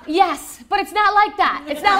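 high, loud laughing reassurance; calm her down while laughing. Retold or imitated. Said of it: "And she was like".

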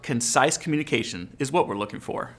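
usual speaking voice

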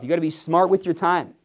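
normal talking voice

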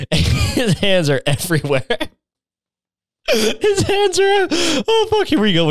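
high, squealing laughter through the words; laughing. Real, not acted. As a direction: crack up mid-sentence. Laughs breaking through reading.

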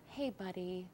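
put-on hushed and coaxing, like calming a toddler; soft talk to a small child. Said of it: "talk to him like a four-year-old".

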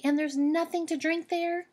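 put-on child rabbit worrying about thirst; high child voice. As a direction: complain like a small bunny.

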